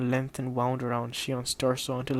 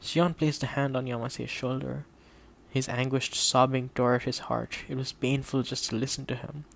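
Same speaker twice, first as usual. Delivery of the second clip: usual speaking voice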